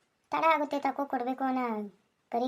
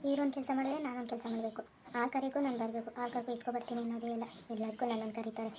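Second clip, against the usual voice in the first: much softer grumble to herself; muttered complaint; 10 dB quieter than usual. Acted. Wife walking off grumbling about her husband, quiet mutter. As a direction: complain under her breath.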